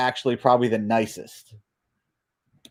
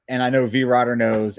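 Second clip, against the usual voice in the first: normal talking voice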